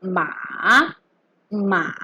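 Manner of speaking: MA dipping low, then rising; long "ma" with a V-shaped pitch curve; third tone. Shown as a demonstration. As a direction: drop "ma" down and bring it back up. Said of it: "ma with the third tone".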